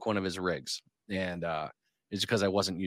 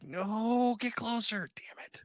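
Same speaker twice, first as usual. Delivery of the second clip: imagined frustrated plea; much slower than usual. Pretend.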